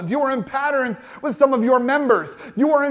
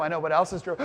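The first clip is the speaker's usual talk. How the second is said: knowing low voice; 7 semitones lower, 6 dB quieter, much faster than usual. Retold or imitated. Repeats the judgment voice.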